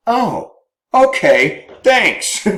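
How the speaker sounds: high, sarcastic brush-off; sarcastic spouse. Retold or imitated. Spouse brushing off the argument, sarcastic and high. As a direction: toss it off as a mock thank-you, pitched well up.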